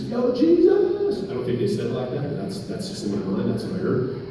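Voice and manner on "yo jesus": high, drawn-out hammed-up holler; playful mock yell, stretched long and way up high; calling out. Blind men calling out to Jesus, comic high holler. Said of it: "they're calling out to Jesus".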